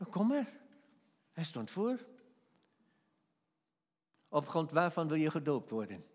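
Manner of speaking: ordinary talk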